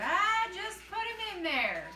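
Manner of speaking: hammed-up slow Grandpa brush-off; imitating Grandpa. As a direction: toss it off like an old man who can't be bothered. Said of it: "He said".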